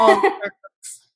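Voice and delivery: words swallowed by a loud, high laugh. Real, not acted. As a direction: burst out laughing through the words. Laughing at her own joke.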